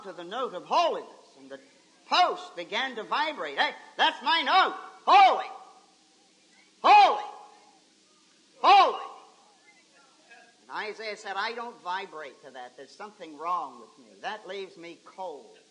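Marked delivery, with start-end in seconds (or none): 3.6-9.1 sudden ringing outburst, each holy punched loud; exultant preacher's shout; ringing cry. Acted. Posts voiced, answering the holiness note. 11.4-15.6 low, hushed admission of failing; quiet, low voice of a man left cold; subdued confession. Acted. Isaiah voiced as out of tune.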